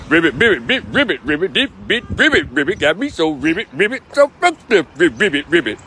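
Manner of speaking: croaked ribbits with a curse slipped in; frog croaking. Pretend. The frog defending himself to the judge, croaking. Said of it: "He love to talk crazy".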